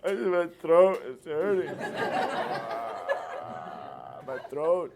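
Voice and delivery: hammed-up wail, slow and loud; comic blubbering, breaking into laughter; mock crying. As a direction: play a patient bawling over a sore throat. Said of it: "a patient starts crying".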